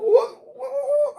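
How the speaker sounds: high, laughing disbelief, repeating what; incredulous squeal. Retold or imitated. Teller to friend, laughing high incredulous what.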